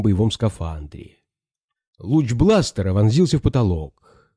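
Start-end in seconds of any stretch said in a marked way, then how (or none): none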